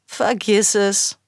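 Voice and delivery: slow, drawn-out smug refusal; a lazy teasing brother; big brother. Acted. Brother refusing his sister the remote, slow and smug. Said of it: "sagte er".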